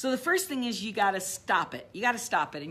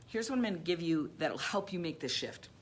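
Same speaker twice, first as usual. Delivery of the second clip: usual speaking voice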